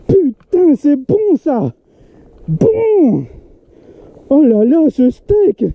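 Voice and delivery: high, thrilled exclamation; overjoyed shout at the catch; excited. Real, not acted. Landing a huge fish.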